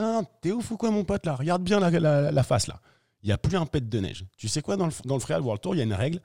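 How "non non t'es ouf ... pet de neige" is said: high, loud heated protest; incredulous refusal. Retold or imitated. Skier turning down the helicopter from the rescuer, heated. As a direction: wave off the rescuer, fired up.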